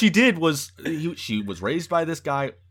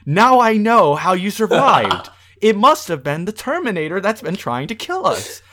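mock revelation; 6 semitones higher, 8 dB louder than usual. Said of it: "she says".

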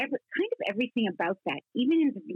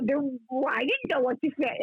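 usual speaking voice

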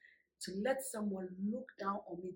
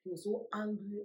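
normal talking voice

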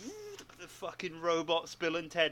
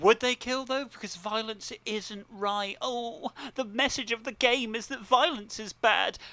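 higher, louder mocking whine; 6 semitones higher, 5 dB louder than usual. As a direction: sneer at the game's message in a whiny voice. Mocking a critic of the game.

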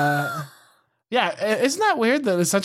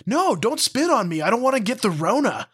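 put-on higher-pitched protesting little kid; preschool kid; 5 semitones higher than usual. Preschooler fending off a spitting classmate, childish protest. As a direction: imitate a small child objecting. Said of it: "the other kid was like".

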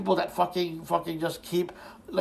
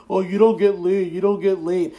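louder, imitating a taunting fan; mock taunt; 2 semitones higher, 8 dB louder than usual. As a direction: throw it out as a mocking playground jeer. Mimics fans' taunting comeback.

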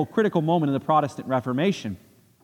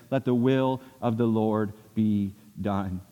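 gentle acceptance; 6 semitones lower, slower than usual. Acted. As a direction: let go and bless them, slowly. Voicing the accepting loved one.

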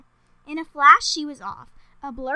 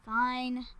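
usual speaking voice

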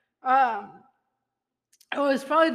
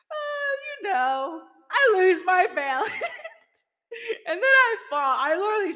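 laughing while telling; 6 semitones higher than usual. Real, not acted. Laughing at her own fall story.

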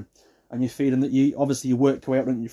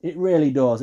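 ordinary talk

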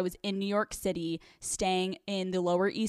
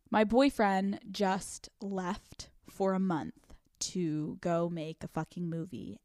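normal talking voice

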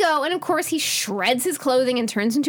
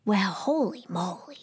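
hushed holy moly; 3 semitones lower, 5 dB quieter than usual. Retold or imitated. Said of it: "she's like".

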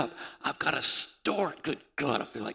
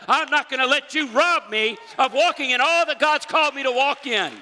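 high, loud preacher's shout; screaming defiance; 10 semitones higher, 7 dB louder than usual. Acted. Giver's tithe screams to the devil, screaming defiance. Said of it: "screams to the devil".

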